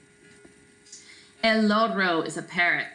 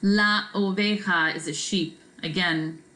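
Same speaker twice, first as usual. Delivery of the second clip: ordinary talk, nothing marked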